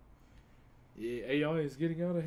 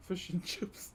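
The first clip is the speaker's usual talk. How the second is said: laughing; 5 semitones higher than usual. Real, not acted. Reading the gift name aloud.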